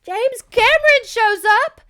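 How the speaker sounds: very high, loud plot reveal; over-excited storytelling at full volume; excited shout. Real, not acted. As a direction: shriek the big entrance. Excited reveal of a new arrival.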